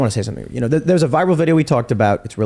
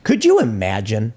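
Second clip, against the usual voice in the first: ordinary delivery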